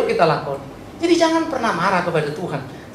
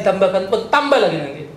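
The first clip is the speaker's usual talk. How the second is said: usual speaking voice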